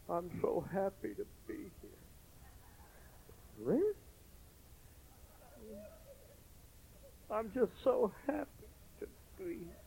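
glum sister. Retold or imitated. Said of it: "looked like she'd just kissed a freight train".

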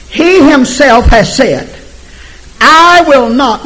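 slow, high, loud scripture read; preaching scripture. Acted. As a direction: proclaim each word slowly. Scripture read at full force.

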